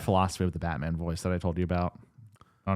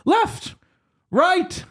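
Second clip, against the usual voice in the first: mock commands; 4 dB louder than usual.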